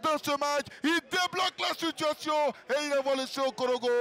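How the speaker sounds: shouted goal call; excited sports commentary. Real, not acted.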